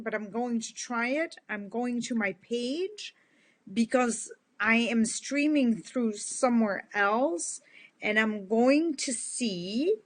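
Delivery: ordinary talk, nothing marked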